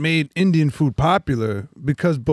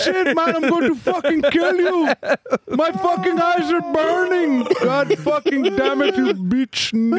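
higher, furious ranting; mock robber yelling; 12 semitones higher than usual. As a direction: rage after the dye pack goes off. Robber hit by dye pack.

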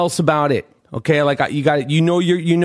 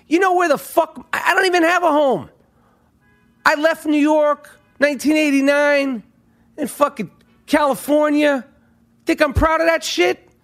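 heated rant, higher; riled up, voice climbing; 10 semitones higher than usual. Real, not acted. Podcaster ranting about leaving New York, heated.

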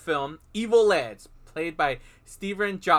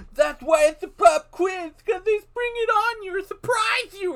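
Evil Ed voice; 7 dB louder than usual. Retold or imitated. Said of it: "and he's like".

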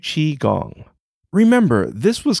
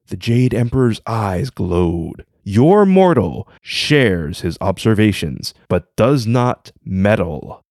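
steady storyteller read over music, lower and calmer than the intro; story narration; 6 semitones lower than usual. Acted. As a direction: tell the legend like a bedtime tale. Story narration with voiced characters.

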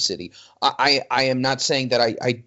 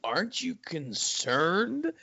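deadpan; 4 semitones higher, 9 dB quieter, much slower than usual. Retold or imitated. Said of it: "deadpan and goes".

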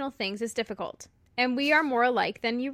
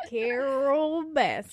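slow, drawn-out mock delivery; hammed-up catchphrase; 2 semitones higher, much slower than usual. Podcaster clowning with her co-host, slow hammed-up drawl. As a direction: stretch the name out playfully.

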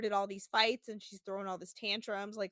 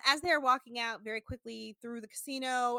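usual speaking voice

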